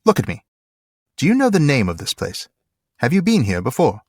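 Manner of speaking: lightly voiced commanding captain; Faramir. A narrator's reading. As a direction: question the prisoner with measured authority. Story reading, Faramir questions Gollum.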